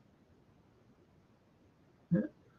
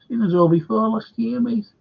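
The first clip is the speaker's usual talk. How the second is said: sarcastic echo, pitched up; mocking older fella; 6 semitones higher than usual. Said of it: "he goes, Daggers, older fella".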